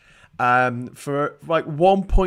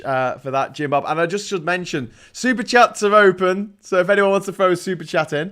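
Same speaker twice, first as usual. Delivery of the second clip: ordinary delivery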